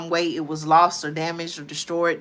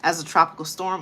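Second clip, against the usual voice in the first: normal talking voice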